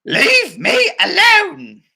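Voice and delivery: high, loud, slow fed-up cry; hammed-up grandma shout. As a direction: bellow at the bears. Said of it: "the old woman shouted".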